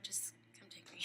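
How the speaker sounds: whispered, resigned plea; mock surrender. Joking she would give up to the creatures, whispered surrender. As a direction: give up under your breath, jokingly.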